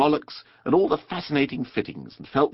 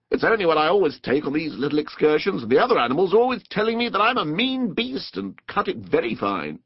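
higher, lively explaining, dropping at the end; earnest water rat; 6 semitones higher than usual. A narrator's reading. Rat explains his usual picnic to Mole, earnest. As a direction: protest modestly, in earnest. Rat's speech in character.

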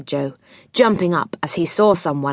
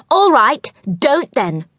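getting cross; 8 semitones higher, 4 dB louder than usual. A narrator's reading. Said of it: "beginning to feel angry".

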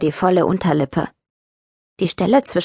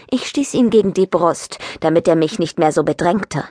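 ordinary delivery

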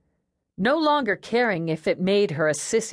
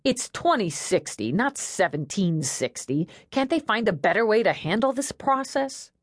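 ordinary delivery

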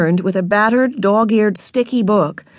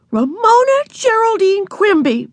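high, exasperated full-name scolding; 13 semitones higher than usual. A narrator's reading. Older sister starts to scold little sister, exasperated.

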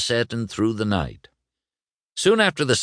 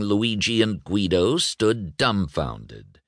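ordinary delivery, nothing marked

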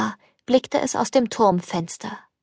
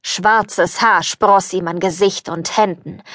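plain reading voice

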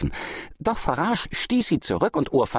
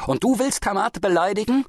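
high, rising incredulous question; pitched-up menacing question; indignant challenge; 13 semitones higher, 4 dB louder than usual. A narrator's reading. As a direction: challenge the insult in outrage. Merchant challenges the farmer again.

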